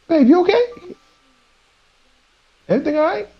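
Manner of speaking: high, gentle mock-sweet concern; mock a tender partner checking in; hammed-up sweet voice asking if babe is okay; soft caring boyfriend. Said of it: "was talking like that".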